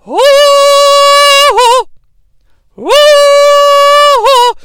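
long, loud, high whooping call. Retold or imitated. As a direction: hold a shrill woo-hoo. Imitating demon's entrance call.

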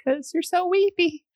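mock him in his weepy voice; weepy caller's voice. Host teasing the weepy caller in his own voice, mock-weepy. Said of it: "make comments in that voice".

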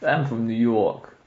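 lightly done New York accent. Shown as a demonstration. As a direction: demonstrate a modest New Yorker accent. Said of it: "No, that's".

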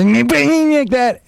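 high, drawn-out mock crying voice; mocking a sobbing woman, slow and high-pitched; imitating snorting crying. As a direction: squeeze it out as a hammed-up wail. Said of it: "she had this horrible snorting and crying".

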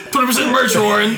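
loud, high mock sales pitch; hammed-up hard sell; masked elf pitch. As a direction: pile on with an urgent offer.

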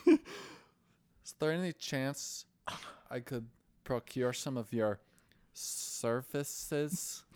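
slow, halting mock-formal plea; awkward, weird request. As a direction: hesitate delicately before the euphemism. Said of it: "he doesn't normally sound like that".

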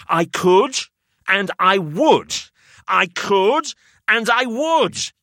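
high, slow insistent repetition; determined mantra. A narrator's reading. Narrator fighting the voice in his head, insistent mantra. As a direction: steel yourself, repeat it.

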